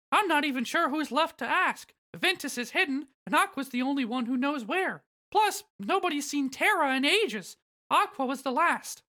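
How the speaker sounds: high falsetto mouse, explaining; Mickey. Acted. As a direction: lay out bad news in a squeaky mouse voice. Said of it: "Mickey shakes his head and says".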